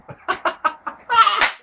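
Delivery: high-pitched burst of laughter. Real, not acted. Reviewer laughing at his own botched accent, high laugh. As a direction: crack up in a high giggle.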